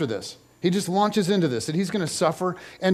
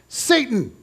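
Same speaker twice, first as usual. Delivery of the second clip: a hammed-up higher cry of Satan; mock outburst; 7 semitones higher than usual. Boss at a brainstorm rejecting an idea, mock shout. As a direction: fling the word like a scandalized boss. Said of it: "your boss stands up and goes".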